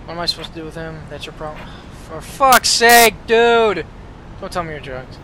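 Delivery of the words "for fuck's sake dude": louder, higher, slow exasperated shout; exasperated yell. Real, not acted. Guest staggering out drunk.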